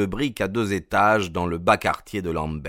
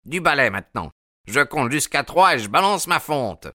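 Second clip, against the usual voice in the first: raised, irate warning; yelled threat; 6 semitones higher than usual. A narrator's reading. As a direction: order the caller off with a threat. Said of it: "hurla monsieur Sherman".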